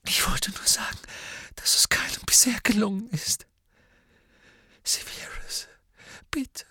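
high, breathy, broken pleading; losing her nerve. A narrator's reading. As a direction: beg in tearful whispers with halting pauses. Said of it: "schien die Nerven zu verlieren".

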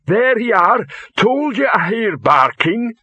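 high, loud troll voice; loud, slowed troll character voice; voice of rock. A narrator's reading. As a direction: call it out slowly and high as a troll. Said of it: "said the voice of rock".

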